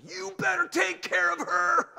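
driving home yelling at God in anger. Retold or imitated. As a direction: scream it at the sky. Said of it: "I yelled at God".

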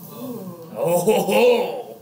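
long drawn-out teasing "ohhh"; swooping, hammed-up wonder; mock-impressed "oh ho ho". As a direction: stretch out a playful ooh of amazement. Mock amazement at new term.

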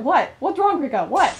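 usual speaking voice

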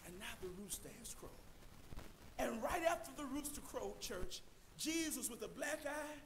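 quiet, sorrowful retelling of the bloodied Jesus; hushed sorrow. Acted. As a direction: drop to a grieving near-hush. Sad crucifixion scene, voice drops.

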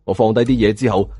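plain reading voice

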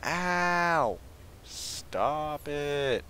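high, loud drawn-out wail; pained protest. Real, not acted. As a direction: whine loudly at being hit. Hit again in game.

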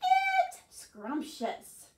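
very high, drawn-out delight; relishing. Acted. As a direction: savour it slowly and loudly. Sheep relishes grass.

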